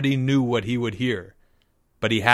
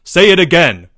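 loud, higher-pitched demand; 5 semitones higher, 10 dB louder than usual. A narrator's reading. Leo to Jeffrey after the poem, loudly demanding. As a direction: call it out as an order. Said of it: "demanded Leo".

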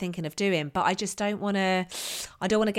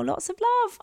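sweet sign-off; 14 semitones higher than usual. Acted. Reading listener's sign-off.

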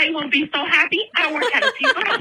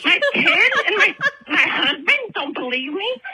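ordinary delivery

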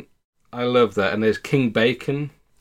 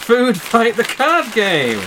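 excited reading; 8 semitones higher, 5 dB louder, much slower than usual. Real, not acted. Reading the box title.

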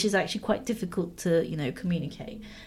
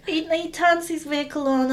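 usual speaking voice

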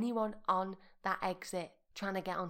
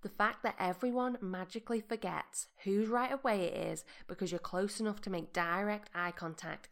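ordinary talk, nothing marked

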